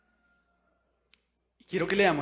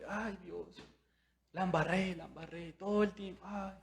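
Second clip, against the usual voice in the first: mock lament; 3 semitones higher, 8 dB quieter than usual. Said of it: "llorar y ahí con un látigo".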